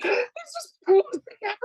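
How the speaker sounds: very high, gasping mock amazement; imitated customer thrill. Thrilled customers after washing crystals, imitated high-pitched awe. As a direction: squeal it like an overwhelmed customer. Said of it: "our customers hug us after they".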